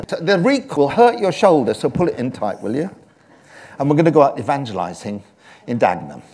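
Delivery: ordinary talk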